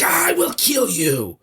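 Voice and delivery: drawn-out growling threat, high start falling away; growly voice. Shown as a demonstration. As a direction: growl it slowly like a big cat. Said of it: "give it that growly voice".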